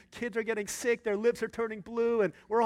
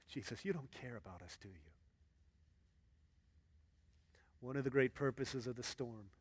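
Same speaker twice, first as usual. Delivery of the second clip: soft sermon; 8 semitones lower, 9 dB quieter than usual. Real, not acted. Pastor speaking softly to the congregation, hushed sermon. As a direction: preach gently, coaxing the listeners to trust.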